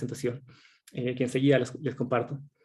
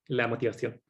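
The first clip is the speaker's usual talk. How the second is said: usual speaking voice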